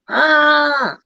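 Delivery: a sharp, high yelled "Ah!"; sudden scream. Retold or imitated. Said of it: "he just screams".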